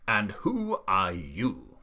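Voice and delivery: slow, lightly voiced old Duke; Duke inquiring. A narrator's reading. Duke pointing stick at Billy, slow questioning. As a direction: draw the question out slowly, sizing up.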